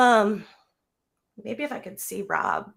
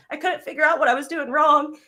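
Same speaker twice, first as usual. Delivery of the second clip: higher and louder, forcing the words out through emotion; tearful but regaining her voice; pushing through tears; 6 semitones higher, 4 dB louder, faster than usual. Real, not acted. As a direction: near tears, thanking her mentor. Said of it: "I'm trying not to cry".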